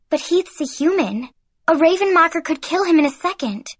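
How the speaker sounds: worried words bursting out fast; blurted out. A narrator's reading. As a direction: blurt it out in alarm. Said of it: "The words burst from my mouth".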